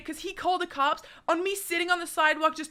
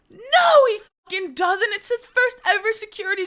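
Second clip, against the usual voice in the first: loud outburst; 8 semitones higher, 6 dB louder than usual. Real, not acted. Angrily rebutting Twitter.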